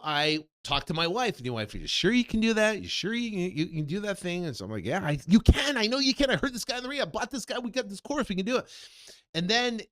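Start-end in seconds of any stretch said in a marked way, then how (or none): 1.7-4.5 higher voice of a doubting wife; doubtful wife. Retold or imitated. Wife doubting his plan. 4.8-8.7 higher, eager pep talk; eager reassurance. Retold or imitated.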